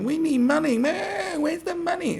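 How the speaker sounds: very high, loud hammed-up wail; mock crying and weeping. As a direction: imitate a begging cry-baby at full pitch. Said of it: "sort of crying and weeping".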